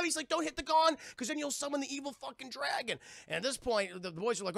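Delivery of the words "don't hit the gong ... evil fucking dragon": high urgent mock plea; panicked warning. Warning about the gong.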